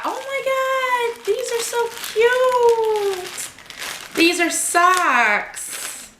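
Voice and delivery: high, slow gush of delight; drawn-out, high-pitched gush over something adorable; squealing slowly over tiny socks; gushing over cute. Real, not acted.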